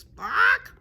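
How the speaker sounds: very loud, high bird squawk; griffin squawk. A narrator's reading. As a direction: burst out with a sharp squawk. Griffin squawks mid-speech.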